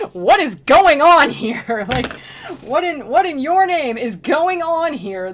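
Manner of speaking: slow, loud, high and baffled; drawn-out bewildered exclamation; baffled disbelief. Retold or imitated. Baffled at weird scene.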